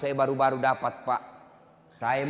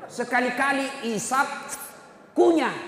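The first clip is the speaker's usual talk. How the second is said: mock exclamation, much higher and louder; mocking smokers; 11 semitones higher, 5 dB louder than usual. Preacher mocks smokers in the audience, loud and high.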